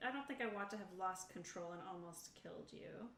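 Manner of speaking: ordinary delivery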